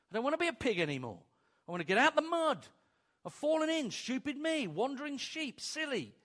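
high, fast, whiny sheep voice; bleating sheep. Acted. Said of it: "We bleat".

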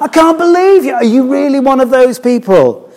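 high, loud disbelieving imitation; mock him with a shrill, scornful disbelief; hammed-up scornful stranger, high and loud; incredulous sneer. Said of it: "It's that kind of".

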